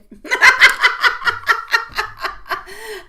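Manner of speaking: high, loud cackling burst; real belly laugh, high and loud; loud laughter. Real, not acted. Laughing during her livestream chat.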